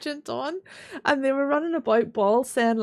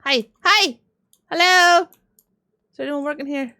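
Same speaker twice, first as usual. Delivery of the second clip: loud, high hello for attention; calling out; 3 semitones higher, 6 dB louder than usual. Pretend. Customer looking for anyone working here, calling out. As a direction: call out to see who is around.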